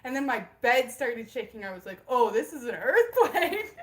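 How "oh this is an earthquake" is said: loud, drawn-out delighted exclamation breaking into laughter; gleeful retold outburst, loud and slow; giddy realization. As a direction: relive the thrill, laughing as it lands. Retells her delighted earthquake realization.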